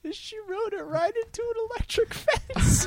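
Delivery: high, cracking with laughter; laughing hard. Real, not acted. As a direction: barely get it out for laughing. Laughing through story.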